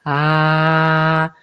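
"ah" grave y sostenido; "ah" desde el pecho. Shown as a demonstration. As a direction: largo y grave, con la mano en el pecho. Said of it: "ponerse la mano y de sentir". ("Ah" grave y mantenido)

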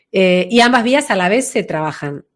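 normal talking voice

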